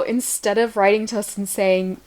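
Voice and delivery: ordinary delivery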